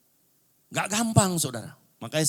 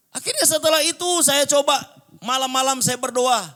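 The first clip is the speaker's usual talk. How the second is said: raised, high testimony voice; loud preaching; 13 semitones higher, 7 dB louder than usual. Real, not acted. Preacher telling congregation of his nightly prayers, loud preaching.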